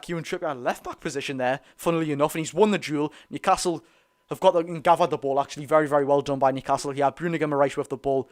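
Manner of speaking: ordinary talk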